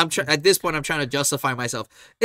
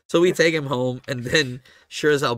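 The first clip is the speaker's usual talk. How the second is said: ordinary talk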